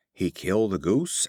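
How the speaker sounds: usual speaking voice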